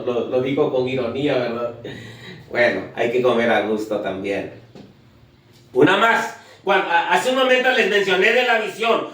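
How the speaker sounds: ordinary talk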